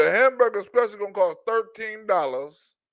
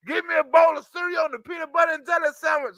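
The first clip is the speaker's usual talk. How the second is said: higher, hollered order for food; mock shouted demand; 5 semitones higher than usual. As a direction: bark the order like a tantrum. Mocking the passenger demanding food.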